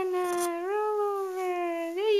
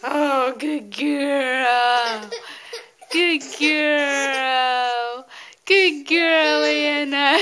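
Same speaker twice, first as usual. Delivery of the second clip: excited praise; 3 semitones lower, 6 dB louder, much slower than usual. Real, not acted. Praising baby after rolling over.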